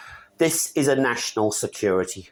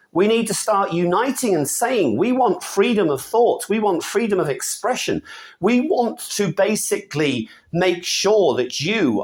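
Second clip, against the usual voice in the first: rising pitch, pounding each repeated "need"; heated rant; 8 semitones higher than usual. Real, not acted. Ranting to viewers about Britain, higher and heated. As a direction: build the rant higher line by line, hammering the point. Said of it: "that's end of rant for today".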